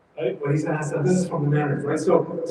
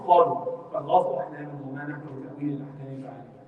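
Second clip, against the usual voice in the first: Quran recitation; 4 semitones lower, 3 dB quieter, much faster than usual. Acted.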